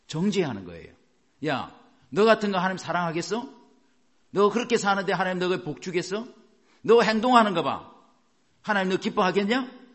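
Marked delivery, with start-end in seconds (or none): 1.5-9.8 high, scornful rhetorical questions; mock accuser's raised voice; accusing attacker. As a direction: taunt them with their failings. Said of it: "이렇게 공격해요".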